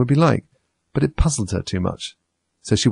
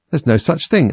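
higher-pitched indignant objection; angry; 7 semitones higher, 4 dB louder than usual. A narrator's reading. Alice rejecting the treacle well, angrily. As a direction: contradict crossly. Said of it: "beginning very angrily".

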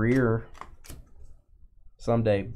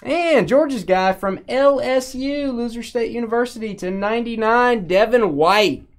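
excited; 10 semitones higher, 6 dB louder than usual. Acted. Hyping a card pull.

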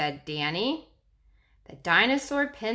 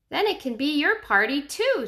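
put-on child voice; 7 semitones higher, 4 dB louder than usual. Danny speaks.

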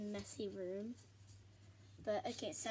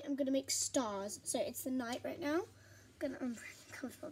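ordinary talk

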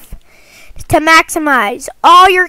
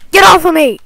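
yelling; 4 semitones higher, 5 dB louder, faster than usual. Real, not acted. Attacked by monster.

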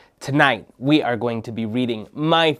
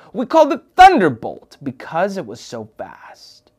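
usual speaking voice

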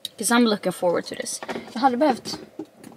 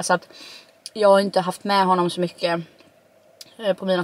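talking between bites; chewing while talking. Real, not acted. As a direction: mouth partly full. Seen 3: repeated bites with chopsticks, mouth working on food while speaking.

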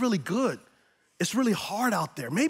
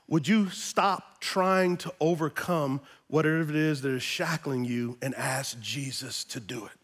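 normal talking voice

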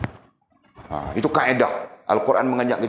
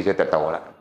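ordinary talk

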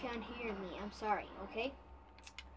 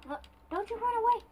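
excited shout; 11 semitones higher, 7 dB louder, faster than usual. Real, not acted. Chasing the fleeing dragon.

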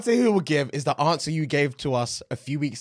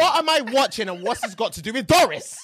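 raised, incredulous voice; acted bafflement; 5 semitones higher than usual. Son asks about his mum's video, high baffled protest. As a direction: demand an explanation, pitched up.

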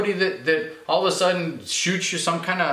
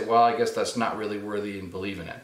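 lower, quick voice of a skeptic; lightly voiced doubter, pitched down; 6 semitones lower, 3 dB quieter, faster than usual. Skeptic judging the believer who walks away, lightly dismissive. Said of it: "the skeptic is gonna say".

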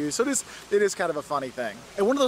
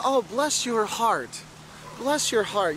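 slow, sugary mock-sweet drawl, pitched higher; sweet Southern put-down; 4 semitones higher, much slower than usual. Southerner mock-sweetly brushing off a clueless tourist. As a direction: pour on fake sweetness, slowly.

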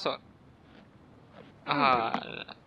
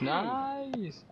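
drawn-out nice; 9 semitones higher, 5 dB quieter, much slower than usual. Real, not acted. Relief after save.

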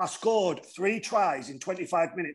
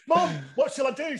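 excited call; 9 semitones higher than usual. Retold or imitated. Calling to mum excitedly.

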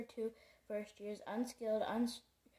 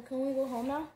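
louder, eager question; girl unable to contain excitement; excited; 3 semitones higher, 7 dB louder than usual. A narrator's reading. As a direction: burst out with excitement. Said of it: "couldn't contain her excitement".

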